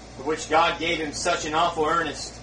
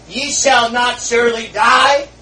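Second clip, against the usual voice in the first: louder, slow tempting drawl with a rising end; Satan's lie; 6 semitones higher, 9 dB louder, slower than usual. Acted. Satan deceiving Eve, a hint of slow taunting. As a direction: coax it like a tempter. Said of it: "he said, "Eve".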